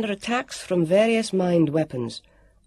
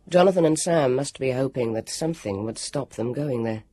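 ordinary delivery

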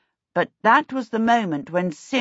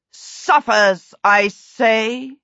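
loud, theatrical villain; dramatic; 3 semitones higher, 5 dB louder, much slower than usual. A narrator's reading. Prince repeating his threat over a hostage, loudly dramatic. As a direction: declaim it with melodramatic force. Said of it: "Prince Ludovic said dramatically".

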